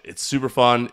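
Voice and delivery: normal talking voice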